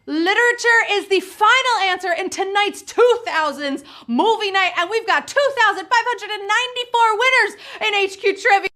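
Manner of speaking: high-pitched, jubilant game-show host; excited winner announcement. Real, not acted. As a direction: celebrate the winners at full energy. Host celebrates the game's winners.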